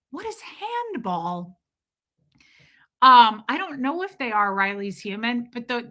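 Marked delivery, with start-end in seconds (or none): 0.1-1.6 high, soft, drawn-out incredulous question; baffled disbelief. Retold or imitated. Streamer replaying her confusion over handball, baffled disbelief. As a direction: ask it slowly in a small, high voice.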